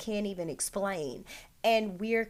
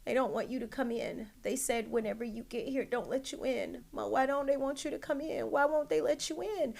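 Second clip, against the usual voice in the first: higher, hurried, plaintive retelling of a son's upset; mock tearful pleading, climbing higher as the questions go on; imitating crying son; 5 semitones higher, faster than usual. Son on the phone to his mom about being kept out, upset and pleading. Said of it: "I'm listening to him cry and he's like".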